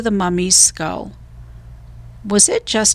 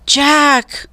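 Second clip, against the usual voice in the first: much higher, louder cry for help; distant cry for help; 7 semitones higher, 3 dB louder than usual. A narrator's reading. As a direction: scream for help from far away. Annie calling from afar.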